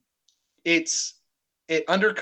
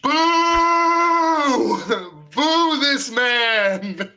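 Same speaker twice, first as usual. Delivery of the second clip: high loud booing, stretched out; mock booing; 11 semitones higher, 6 dB louder, much slower than usual. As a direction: boo him like an angry crowd. Booing co-host for bad joke.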